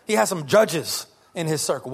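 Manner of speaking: ordinary talk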